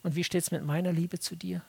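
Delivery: soft, low plea for judgment; hushed, low, sincere prayer; humble prayer. Retold or imitated. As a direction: pray quietly and earnestly for honesty. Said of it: "in diesem einen Punkt sagen sollten".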